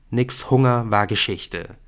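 plain reading voice

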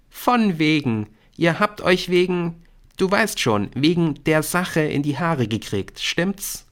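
put-on high, insistent girl's voice; knowing schoolgirl. A narrator's reading. Brynn tells Nick she knows about the quarrel, high and knowing.

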